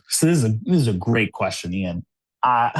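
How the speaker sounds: ordinary delivery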